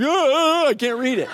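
high, strained squint at the bill; hammed-up can't-read-it whine; squinting friend. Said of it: "I'm like".

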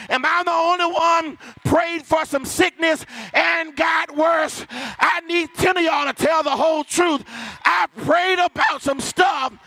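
high, ringing church preacher cadence; rousing call to the congregation; full-force preaching. Real, not acted. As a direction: preach it out, stretching the key words.

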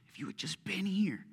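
whispered, broken plea through tears; mock sobbing. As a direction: breathe it out like a weeping sister.

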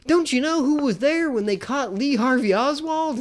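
high-pitched mock know-it-all question; imitating an oblivious trivia pest; pestering trivia nerd. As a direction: lift the voice high, quizzing them insistently. Acting out Eric's pestering movie trivia.

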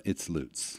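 Lutz said softly as "loots"; local long U pronunciation. Shown as a demonstration. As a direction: quietly insist on Lutz with a long U. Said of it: "born and raised in Tampa".

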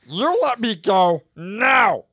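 loud, slow menacing demand; slow loud threat. Acted. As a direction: lean on each word, threatening. Character threatens snake.

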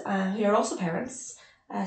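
ordinary talk

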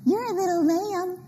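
sweet prophecy. Retold or imitated. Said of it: "then they'd go".

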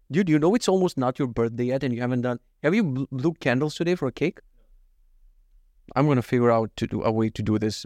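ordinary talk

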